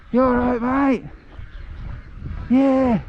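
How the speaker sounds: high, carrying Aussie call down the hill; cheery Aussie bloke calling out; Aussie accent. Retold or imitated. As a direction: call down to your mate in a broad Aussie accent. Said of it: "That's my Aussie accent".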